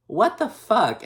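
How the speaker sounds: high-pitched disbelieving outburst; baffled, appalled reaction; incredulous. Real, not acted.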